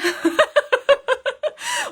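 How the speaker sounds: a burst of high real laughter; bright amused giggle. Real, not acted. Laughing at own mock question.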